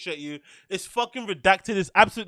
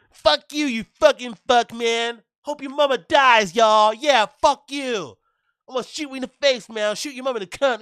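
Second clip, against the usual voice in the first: put-on higher, louder, shouting like an angry gamer kid; Call of Duty lobby kid; 6 semitones higher, 5 dB louder than usual. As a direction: yell like a raging teen on a game headset. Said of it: "that's what he sounds like. Those Call of Duty lobby guys".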